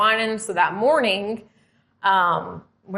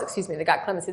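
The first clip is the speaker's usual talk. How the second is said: usual speaking voice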